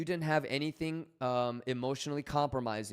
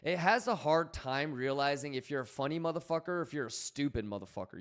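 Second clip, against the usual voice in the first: ordinary talk, nothing marked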